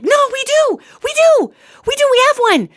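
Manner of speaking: high, loud excited blurt; excited shout. Retold or imitated. Pet store owner stopping him leaving, in high excited cries. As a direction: burst out, desperate to sell the puppy. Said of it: "she freaks out".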